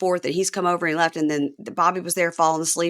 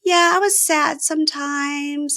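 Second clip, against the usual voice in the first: gently voiced mom; 9 semitones higher than usual. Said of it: "she goes".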